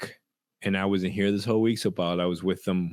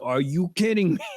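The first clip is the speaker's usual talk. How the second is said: disbelief; 9 semitones higher than usual. Retold or imitated. Father reacting in disbelief.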